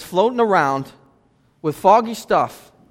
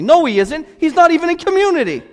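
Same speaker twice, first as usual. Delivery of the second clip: higher and louder, insistent; loud high rebuttal; 8 semitones higher, 4 dB louder than usual. Real, not acted. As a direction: drive the point home. Preacher rebutting loudly.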